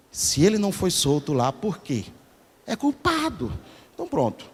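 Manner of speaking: quietly imitating the prosecutor's logic; mock prosecutor. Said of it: "eu vejo o promotor falando isso aqui". (imitação contida e baixa do promotor)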